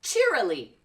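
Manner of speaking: ordinary talk, nothing marked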